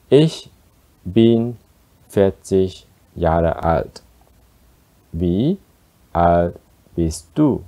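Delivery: slow, each word set apart. Shown as a demonstration. Teacher slows down for learners. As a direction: take it word by word. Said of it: "I'll speak slower".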